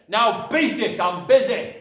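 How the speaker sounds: irritated dad's bellow; dismissive shouting father. A narrator's reading. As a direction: bark at the child to clear off. Father dismisses daughter angrily.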